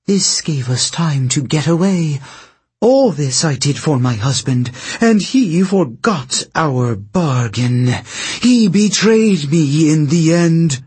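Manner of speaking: a queen insisting on her innocence; scheming princess voice. Acted. Princess defends herself.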